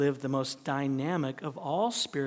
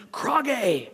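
mock war call; 6 semitones higher than usual. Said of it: "He said to them".

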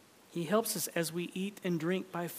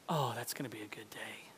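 whispered aside; 6 semitones lower, 6 dB quieter than usual. Real, not acted. Aside to congregation.

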